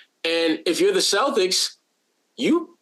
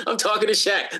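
higher, giggling excited-kid imitation; giggly little kid; 4 semitones higher than usual. Imitating a young player thrilled to meet Shaq, giggly. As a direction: giggle through it like a starstruck kid. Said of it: "like a little kid giggling".

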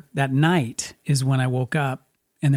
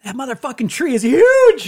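much higher, louder astonished exclaim; amazed; 10 semitones higher, 6 dB louder than usual. Real, not acted. Host seeing the old house tree grown huge, amazed. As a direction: blurt out how huge it got.

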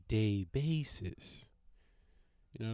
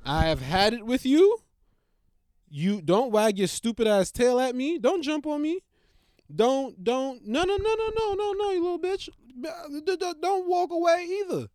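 loud, high scolding, climbing to a squeak; yelling at dog; 10 semitones higher, 6 dB louder than usual. Real, not acted. Scolding the dog for jumping, loud and high. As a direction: chew the dog out at full volume.

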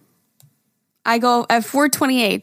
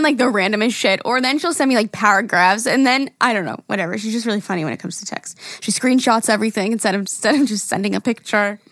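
usual speaking voice

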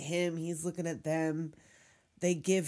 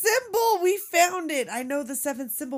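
much higher, louder, tearful slowing cry; overjoyed discovery; 6 semitones higher, 7 dB louder, slower than usual. Retold or imitated. Retelling Jackson's discovery, mock tearful joy. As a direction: act out an overjoyed breakthrough.

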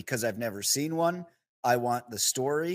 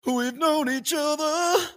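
impression; 4 dB louder, much slower than usual. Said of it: "he goes".

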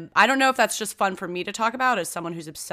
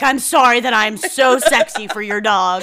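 mock awkward apology; 10 dB louder than usual. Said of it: "and you're like".

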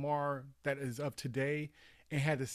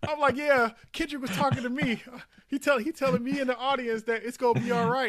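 higher and louder, laughing through it; excited laughing retelling; 10 semitones higher, 9 dB louder than usual. Real, not acted. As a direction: tell it bursting with amusement.

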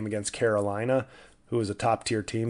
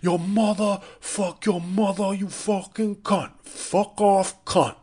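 put-on New York accent; 9 semitones higher, slower than usual. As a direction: imitate a crass New York heckler yelling. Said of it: "atrocious New York Mike Francesa accent".

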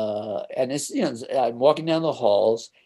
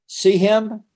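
high, louder jab: see him?; sudden pointed question; 10 semitones higher, 5 dB louder than usual. Retold or imitated. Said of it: "he says".

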